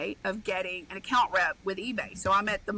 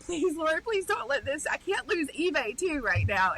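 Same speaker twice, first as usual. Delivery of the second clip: pleading prayer; 6 semitones higher than usual. Retold or imitated. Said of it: "saying prayers to God".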